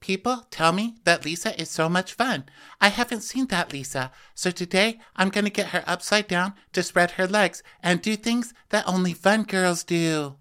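high, teasing Whitney voice; mock Whitney. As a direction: scheme like a mischievous Whitney. Voicing Whitney's confession.

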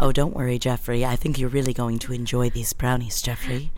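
low, soft, sinister sweetness; creepy soft voice. Pretend. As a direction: purr it low and quiet. Acting creepy wife voice.

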